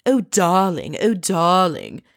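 lightly mocking la-di-da darling, drawn out; mocking posh countess. Podcaster mocking the countess's airs, in a posh put-on voice. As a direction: mimic a pretentious countess cooing darling. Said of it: "pretentious countess stuff".

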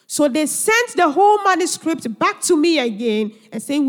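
ordinary talk, nothing marked

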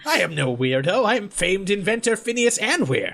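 proud, offended inventor; inventor Phineas. Acted. Inventor correcting a hunter who called him weirdo, proud protest. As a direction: announce yourself grandly, pitch climbing.

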